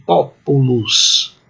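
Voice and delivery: "populus" slow, with long stressed first syllable; demonstrated pronunciation. Shown as a demonstration. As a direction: say "populus" slowly as POO-pu-lus, with an O sound held. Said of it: "Então a pronúncia é assim".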